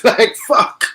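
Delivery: loud frustrated swear; exasperated curse. Real, not acted. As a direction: throw up your hands at it. Regrets painting Man City blue.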